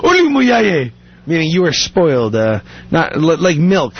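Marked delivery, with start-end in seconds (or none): 0.1-0.9 high imitation of scandalized Ugandans; shocked Ugandan. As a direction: scold in a high Ugandan accent. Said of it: "they would be in shock".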